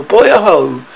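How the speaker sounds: loud, ringing hail; ringing call. A narrator's reading. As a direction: call out across the water. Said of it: "rang over the".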